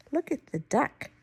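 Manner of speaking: ordinary delivery, nothing marked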